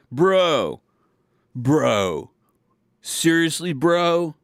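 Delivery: mock a Southern California surfer dude, bro after bro. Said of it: "he's a California dude".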